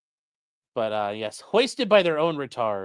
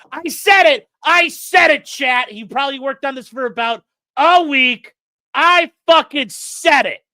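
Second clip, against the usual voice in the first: loud gloating yell, louder and higher; triumphant shout; 8 semitones higher, 9 dB louder than usual. Real, not acted. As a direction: shout it out, thrilled to be right. Gloating over his prediction.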